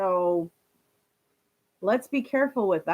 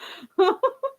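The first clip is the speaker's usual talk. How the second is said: high rising real laugh; quick high giggle; 12 semitones higher than usual. Real, not acted. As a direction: giggle upward. Laughs after joke.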